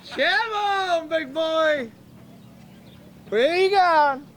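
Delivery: high, loud call across the pits. Real, not acted. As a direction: shout to a driver walking past. Calling a driver over.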